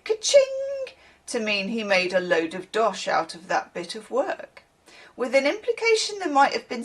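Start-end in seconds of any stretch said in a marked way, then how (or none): 0.0-0.9 "ka-ching" sung out way up high; "ka-ching" in a high cash register ring; ka-ching sound. Pretend. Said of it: "like when we say".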